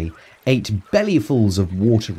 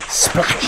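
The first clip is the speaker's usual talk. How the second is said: splash said as a noise; splash sound. A narrator's reading. Tyrannosaurs falling into the river, whooshed splash. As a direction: burst the word out like water.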